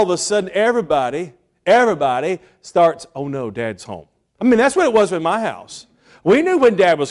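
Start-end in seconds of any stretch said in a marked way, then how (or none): 3.2-4.1 put-on dreading kids. Kids dread dad's arrival.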